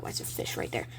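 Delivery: hushed, breathy puzzled whisper; a quiet confused whisper to yourself; whispered aside. Real, not acted. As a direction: mutter it under your breath, puzzled. Kid puzzled aside at comic.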